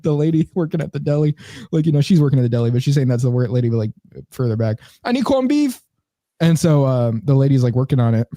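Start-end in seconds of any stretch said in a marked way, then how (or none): 5.0-5.8 high mock call in a Caribbean accent. Deli worker calls to coworker further back, mock Caribbean accent. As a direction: imitate a Caribbean worker calling, pitched way up. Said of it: "She's, like, yelling at her".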